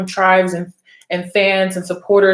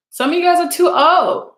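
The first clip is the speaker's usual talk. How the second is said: calling out; 8 semitones higher, 4 dB louder than usual. Real, not acted. Calling out older viewers.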